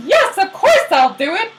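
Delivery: high, loud, gushing agreement; hammed-up eagerness; eager yes. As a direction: leap at it like an overkeen errand boy. Hermes eagerly obeys Zeus.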